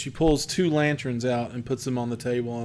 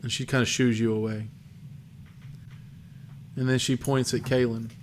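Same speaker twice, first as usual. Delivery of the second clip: ordinary talk, nothing marked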